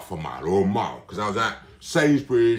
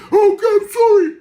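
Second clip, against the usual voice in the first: very high, loud whiny surrender; squeaky mock apology; 12 dB louder than usual. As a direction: wail it like a beaten coward. Doctor's voice giving up.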